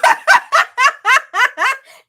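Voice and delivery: high, loud burst of real laughter. Real, not acted. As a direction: cackle out loud at your own tease. Laughs after teasing viewers.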